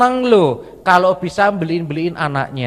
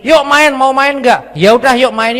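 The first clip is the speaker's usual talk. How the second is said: higher, louder eager invite; eager invitation; 8 semitones higher, 5 dB louder than usual. Retold or imitated. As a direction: call them out to play.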